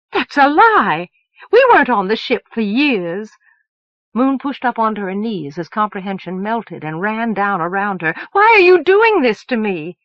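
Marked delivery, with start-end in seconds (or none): 0.1-3.3 loud, high protest; outraged denial. Acted. Moon to Elsevier who revealed the years: loud outraged denial. 8.3-10.0 much higher and louder, betrayed; anguished cry. Acted. Moon to Elsevier who lied to her: loud anguished cry.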